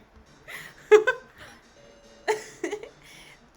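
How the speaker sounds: high loud laugh. Real, not acted. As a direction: burst out laughing.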